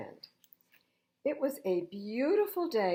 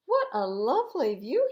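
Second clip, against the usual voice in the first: bright delighted cry, higher and louder; excited exclaim; 6 semitones higher, 9 dB louder than usual. A narrator's reading. Thomas marvelling at the mountain view, excited. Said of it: "he exclaimed".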